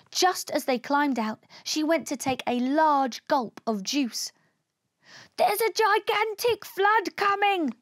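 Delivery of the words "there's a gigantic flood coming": high, urgent warning; panicky, high-pitched alien; alarmed cry. A narrator's reading. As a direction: cry out that danger is coming. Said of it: "cried Og".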